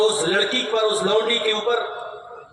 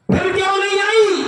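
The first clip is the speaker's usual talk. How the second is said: stern question; 7 semitones higher than usual. Retold or imitated. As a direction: press the girl for an answer. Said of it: "कहा".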